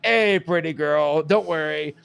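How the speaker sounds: loud, drawn-out mock flirting; Hamilton. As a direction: lay on a slow, hammed-up come-on.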